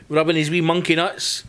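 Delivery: ordinary talk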